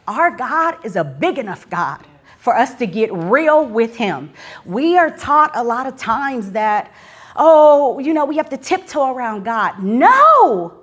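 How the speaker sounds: loud, high, fervent sermon voice; rising preacher's fire, loud and high; preaching at full force. Acted. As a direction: preach it out to the whole room. Preaching to the congregation.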